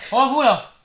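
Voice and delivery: crie sur le passant, fort et aigu; engueulade. Acted. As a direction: hurle comme un conducteur furieux. (engueulade de racaille au volant)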